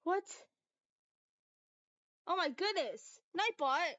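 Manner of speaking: high, loud startled exclamation; real exasperated outcry. Real, not acted. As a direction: cry out in dismay at a glitch. Exclaims at misbehaving chat bot.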